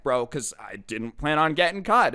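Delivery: ordinary delivery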